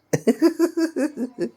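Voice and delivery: high-pitched rippling giggle; real, very high laugh; giggling. Real, not acted. Laughs while being teased.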